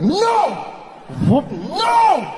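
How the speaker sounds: very high, straining cry; strained refusal. Acted. Comic ordering his body not to vomit, high strained shout. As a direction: fight down the sick with a desperate command.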